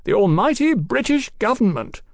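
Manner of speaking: high, projected sneer; taunting. A narrator's reading. Captain taunts the government over hostage goods, mocking. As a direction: jeer grandly at the authorities. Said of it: "Swing taunted".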